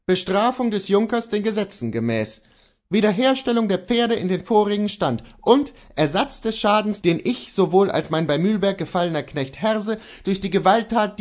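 a defiant man holds his ground; Kohlhaas voice. Acted.

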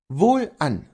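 a defiant man holds his ground; Kohlhaas voice. Acted. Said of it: "versetzte Kohlhaas".

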